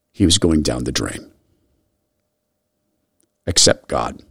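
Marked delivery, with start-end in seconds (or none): 3.5-4.2 low, soft and sincere; prayerful. Real, not acted. Host praying for a lost boy, low and soft. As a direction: pray quietly from the heart.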